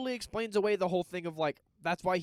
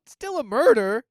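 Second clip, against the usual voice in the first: teasing; 7 semitones higher, 11 dB louder, slower than usual. Real, not acted. Teasing retort.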